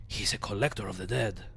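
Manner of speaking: raspy undead mortician voice; raspy old zombie. Acted. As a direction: rasp it out like a weary corpse. Voicing Dahl the zombie.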